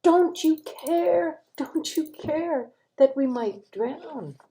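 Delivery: high, loud, slow desperate cry of frightened disciples; panicked plea. Acted. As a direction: beg for help as the boat sinks. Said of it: "And they say".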